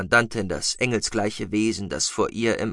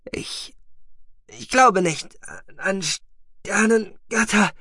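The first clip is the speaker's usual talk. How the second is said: forced out through gritted teeth; squeezed out past clenched teeth; 10 semitones higher, slower than usual. A narrator's reading. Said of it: "zwischen zusammengepressten Zähnen heraus".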